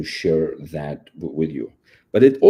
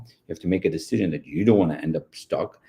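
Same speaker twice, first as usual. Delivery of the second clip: ordinary delivery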